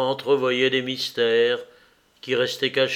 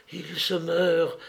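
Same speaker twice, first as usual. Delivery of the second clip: slow, higher, mildly frightened delivery; frightened stammer; 4 semitones higher, slower than usual. A narrator's reading. Woman running in to report her dying man, mildly frightened. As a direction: falter slowly through the dread. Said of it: "balbutia-t-elle".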